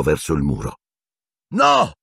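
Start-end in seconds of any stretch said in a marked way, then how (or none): 1.5-1.9 very high-pitched cry; sudden yell. A narrator's reading. Harry leaping before the wands, high yell. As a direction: cry out to stop them. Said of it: "urlò Harry".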